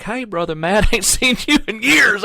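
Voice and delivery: put-on high, laughing good-ol'-boy greeting; hammed-up delighted hello; cheery old friend. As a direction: mimic a jovial Southern gospel singer. Old acquaintance greets him in bathroom.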